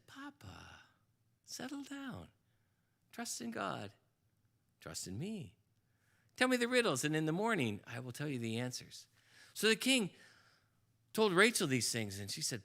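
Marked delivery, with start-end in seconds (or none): 0.1-9.1 much softer, calm reassurance; soothing daughter. Acted. Daughter Rachel calming her father again, soft and soothing. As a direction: hush him like a child.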